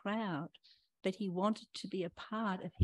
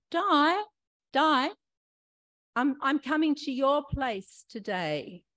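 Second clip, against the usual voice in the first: raised, louder voice hailing someone; calling out; 5 semitones higher, 7 dB louder than usual. Acted. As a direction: call a name across a crowd. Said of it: "called to me in the crowd".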